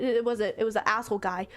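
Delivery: ordinary delivery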